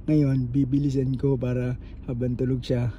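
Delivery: low whisper so she stays asleep. Real, not acted. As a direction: hush it down, she's sleeping. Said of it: "hinihina ko lang yung boses ko".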